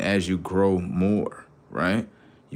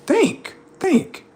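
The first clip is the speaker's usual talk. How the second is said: high insistent urging. Real, not acted. Streamer urging chat to think, high insistent call. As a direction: push them to think, high. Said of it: "urging the audience to think".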